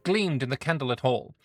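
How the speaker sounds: storyteller reading an event; story narration. A narrator's reading. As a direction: read it like a fantasy tale. Reads event story aloud.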